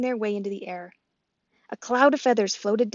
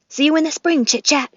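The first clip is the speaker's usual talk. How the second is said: gosling voice; 7 semitones higher, 8 dB louder than usual. Acted. The gosling speaks.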